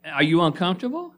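ordinary talk

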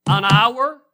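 high and very loud, outraged question; loud incredulous cry. Real, not acted. Preacher mocking the excuse, loud and incredulous. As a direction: throw the question back in disbelief.

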